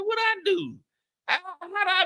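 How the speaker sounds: squeaky, high and hurt; mock-offended high voice; high indignant squeak. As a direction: protest in a high wounded voice. His indignant reply.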